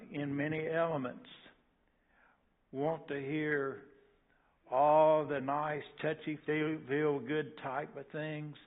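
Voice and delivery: rising pulpit fervor, voice lifted; preaching. Acted. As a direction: hammer it home from the pulpit. Preaching at full force.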